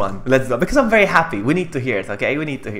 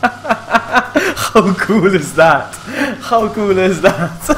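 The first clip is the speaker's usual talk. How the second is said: loud, higher, laughing delight; 5 semitones higher, 5 dB louder, much slower than usual. Real, not acted. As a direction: crack up while exclaiming. Delighted at the alert.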